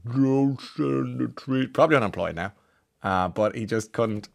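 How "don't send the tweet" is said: slow mock plea. Pleading with the tweeter to stop.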